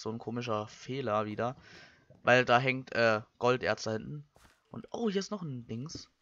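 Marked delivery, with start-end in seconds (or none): none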